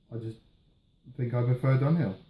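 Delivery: ordinary talk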